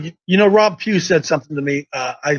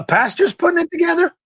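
surprised; 11 semitones higher than usual. Acted. Host feigns amazement at pastor.

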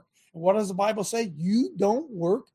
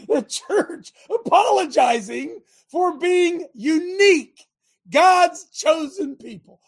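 pained preaching cry; 8 semitones higher, 6 dB louder, slower than usual. Real, not acted. Preacher cries out at church.

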